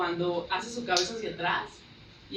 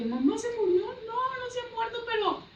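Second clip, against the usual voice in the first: alarmed news; 3 dB louder than usual. Retold or imitated. Panicked relative on phone.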